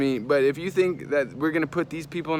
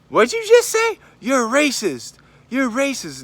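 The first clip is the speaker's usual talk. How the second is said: high, loud, accusing mock yell; imitated yelling; 11 semitones higher, 6 dB louder than usual. Customer accusing the girl behind the cashier, mock yelling. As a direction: yell it like an outraged accuser, pitched way up. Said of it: "just starts yelling at the poor girl".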